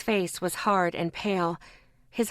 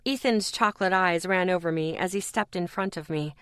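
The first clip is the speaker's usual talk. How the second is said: ordinary delivery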